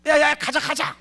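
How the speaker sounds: high, loud shout of an enraged master; angry outburst. Acted. Naaman storming off shouts at his servants, angry outburst. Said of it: "막 화를 내고 있어요".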